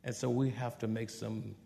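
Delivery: soft, patient parental talk; calm but firm. Shown as a demonstration. Parent correcting a child with a smile, calm and soft. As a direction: gently reason with a child, meaning business. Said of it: "be calm and mean business and tell your children with a smile".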